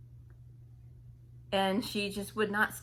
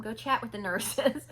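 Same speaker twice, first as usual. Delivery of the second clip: usual speaking voice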